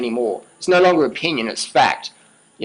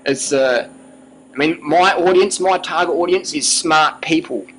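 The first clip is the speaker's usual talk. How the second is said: ordinary delivery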